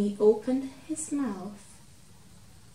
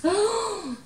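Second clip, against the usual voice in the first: sharp frightened intake of breath; startled gasp; 11 semitones higher, 3 dB louder than usual. Acted. Mouse meets the lion, frightened gasp.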